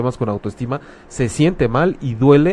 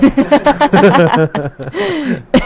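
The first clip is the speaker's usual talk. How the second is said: bursting into high laughter; loud laugh; 12 semitones higher, 6 dB louder than usual. Real, not acted. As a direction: let out a big real laugh. Laughing at the Lencho joke.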